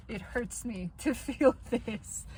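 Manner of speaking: slow, rueful laugh through the words; laughing through pain. Real, not acted. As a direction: laugh at how much it hurts. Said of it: "I'm laughing, but it hurts".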